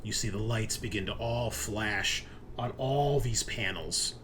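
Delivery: usual speaking voice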